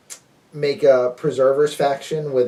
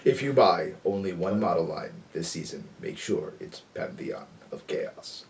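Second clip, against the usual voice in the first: movie announcer voice; 8 semitones lower, 10 dB quieter than usual. Shown as a demonstration. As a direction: sell it like a movie trailer. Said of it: "use my announcer voice".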